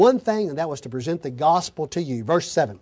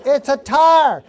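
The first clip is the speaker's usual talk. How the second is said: exasperated thought; 7 semitones higher, 7 dB louder, slower than usual. Retold or imitated. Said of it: "I'm thinking".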